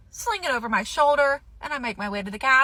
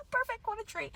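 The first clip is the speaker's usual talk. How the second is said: high and softer with glee; delighted; 11 semitones higher, 10 dB quieter than usual. Real, not acted. Shopper savours the deal, high and softer. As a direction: purr it, thrilled.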